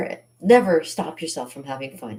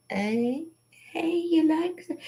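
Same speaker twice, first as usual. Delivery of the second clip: cutesy question; 14 semitones higher, much slower than usual. Pretend. Showing makeup to viewers.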